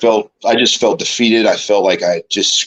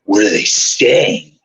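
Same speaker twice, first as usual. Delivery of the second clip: slow, lightly voiced boss; Vince McMahon; slower than usual. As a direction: draw out the question in a light boss impression. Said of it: "he gets right in my face".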